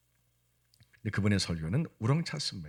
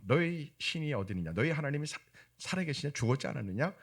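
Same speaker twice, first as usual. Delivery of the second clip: mocking; 6 semitones higher than usual. People jeer at the psalmist's faith, mildly mocking. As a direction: imitate scoffers doubting someone's God. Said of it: "막 조롱하며 비웃을 때".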